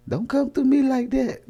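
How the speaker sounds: high-pitched mock complaint to the spirits; comic high plea to back off; high protest. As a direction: object in a high, strained pitch. Pleading with imagined spirit guides.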